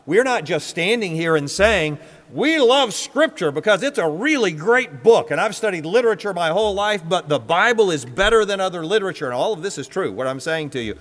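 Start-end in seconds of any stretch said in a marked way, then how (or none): 2.3-9.3 high, quickening, gushing voice; enthusiastic book lover. Acted. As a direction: gush about a favorite book, pitched up. Voices a view he says falls short.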